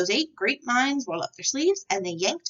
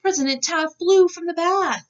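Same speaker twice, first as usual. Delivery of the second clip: excited climax; 10 semitones higher, 7 dB louder than usual. A narrator's reading. Climax of story narration.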